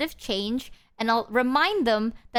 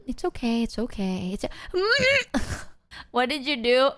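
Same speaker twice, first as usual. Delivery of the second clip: a light, higher soothing friend voice; mock sympathetic girlfriend; imitating Numi; 4 semitones higher than usual. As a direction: hum it out like a gentle friend calming you. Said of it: "this is how Numi sounded".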